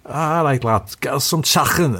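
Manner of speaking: high-pitched mock Scouser; hammed-up Liverpudlian accent. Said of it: "say with a Liverpudlian accent".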